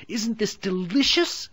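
high, loud, talking through candy; a delighted girl with a mouthful; mouth full. A narrator's reading. Gretel chewing candy, delighted and high. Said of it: "with her mouth full".

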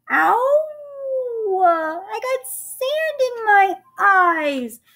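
high, loud, drawn-out whine; slow, hammed-up whining; whining pirate. A narrator's reading. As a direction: wail it like a big baby. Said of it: "Pinky whined".

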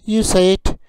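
slow, loud, carefully clear teacher drill; vocabulary drill. Acted. Teacher drilling kitchen words for learners, slow and clear. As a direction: model each word for learners to repeat.

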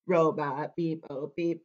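low monotone robot. Acted. Storyteller shows kids how to talk robot, monotone robot voice. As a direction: speak on one note like a machine. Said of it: "talk like a robot".

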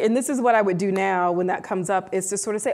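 usual speaking voice

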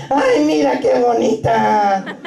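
put-on slow nasal northern Mexican accent; nasal norteño Spanish come-on. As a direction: say it through the nose like a northern Mexican flirt. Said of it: "a little more nasally".

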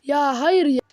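loud exasperated cry; frustrated outburst. Real, not acted. Gamer losing a fight, loud dismayed cry. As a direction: let the frustration burst out loud.

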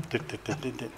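soft "dit" beeps like a ringing phone; soft imitated dial tones. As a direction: mimic the tones quietly.

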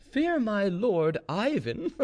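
slow, high mocking question breaking into laughter; loud laugh. A narrator's reading. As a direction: laugh at him as you ask, high and drawn out. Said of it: "said Gregory with a loud laugh".

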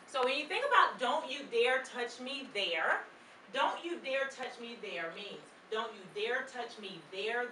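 ordinary delivery

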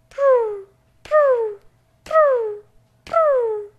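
high cooing pigeon purr, each one falling. A narrator's reading. Reading to a child, pigeons cooing in a high purr.

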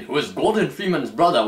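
usual speaking voice

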